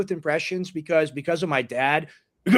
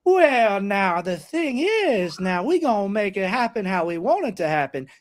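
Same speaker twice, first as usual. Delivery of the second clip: slow hammed-up Boss Hog accent; Southern boss accent; 3 semitones higher, slower than usual. Shown as a demonstration. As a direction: lift the octave and lay on the drawl. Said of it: "I just have to elevate my octave ... add the accent".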